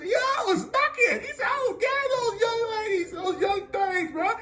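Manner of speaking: very high, hammed-up bragging; boastful old teacher. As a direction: imitate an old teacher crowing about his youth. Teacher boasts about his youth.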